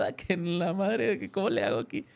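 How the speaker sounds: high, half-joking panicked blurt; mock alarm. Retelling being overpowered by the possessed woman, mock alarm. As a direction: play your own startled panic at her strength.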